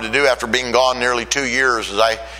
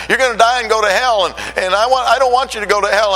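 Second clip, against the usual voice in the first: much higher, louder tearful pleading; crying plea; 10 semitones higher, 3 dB louder than usual. Retold or imitated. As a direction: beg them through tears. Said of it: "And I was crying".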